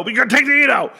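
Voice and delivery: high loud exaggerated nagging; mock parent insisting. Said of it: "child and father scene".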